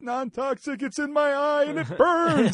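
high, loud, laughing whine of disbelief on "Non-toxic"; outraged reply. Retold or imitated. Burned Marine shouts back at his buddy, incredulous and laughing. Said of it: "He's like".

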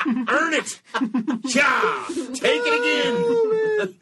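comic overexcited self-coaching; hammed-up macho cheer. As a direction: pump yourself up like a cartoon stud.

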